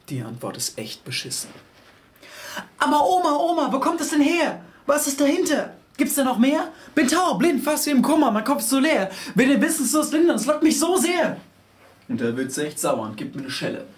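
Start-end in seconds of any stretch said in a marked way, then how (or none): 2.8-11.4 put-on high, loud, whiny kid voice; pleading child. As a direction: beg grandma like an impatient child.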